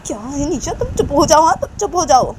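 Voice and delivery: high, insistent hushing; scolding mother. Acted. Mother orders Nisha to be quiet. (ऊँची आवाज़ में डाँटकर चुप कराना)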